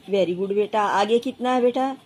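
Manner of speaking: ordinary delivery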